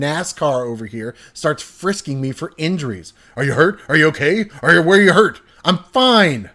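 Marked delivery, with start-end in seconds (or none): 3.4-5.4 loud, rushed worried questions; frantic parent checking for injuries; panicked dad. Retold or imitated. Dad frisking his son after the near crash, loud and frantic. 5.6-6.5 loud, higher tearful yell; screaming through tears. Retold or imitated. Crying kid yelling at dad after the scare, tearful shout. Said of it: "I scream through my snotty tears".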